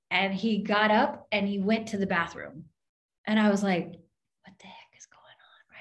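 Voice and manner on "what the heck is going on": hushed, breathy whisper of disbelief; a startled whisper to herself; whispered aside. Retold or imitated. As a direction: whisper it as if nobody should hear. Retelling her shocked reaction.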